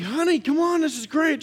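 high-pitched, eager call to a wife; excited calling out. Retold or imitated. Husband urging his wife into the water, excited high call. Calls to wife while riding.

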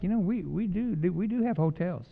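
1 mock polite hint. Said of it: "I'm like".

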